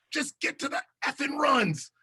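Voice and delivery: mock annoyed producer over the feedback line; pissed-off producer. Producer fed up in the truck, snapping at the host. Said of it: "Oh, they're pissed in there".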